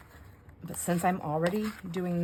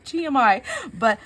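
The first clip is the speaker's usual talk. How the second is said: laughing; 8 semitones higher, 11 dB louder than usual. Real, not acted. Laughing at her own pet peeve.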